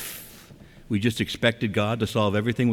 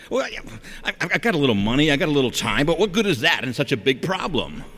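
higher, hurried mock excuse; flustered protest; 5 semitones higher than usual. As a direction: stammer into a worried, doubtful objection. Acting out a doubter's worried excuse.

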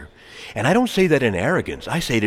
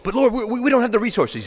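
high, stammered excuse; pitched-up, flustered objection; protesting disciple; 10 semitones higher, faster than usual. Acted. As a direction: plead helplessness to the Lord.

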